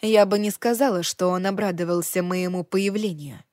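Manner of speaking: ordinary delivery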